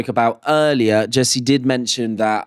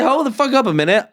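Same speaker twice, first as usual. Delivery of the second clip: mock outrage; 9 semitones higher, faster than usual. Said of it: "I said".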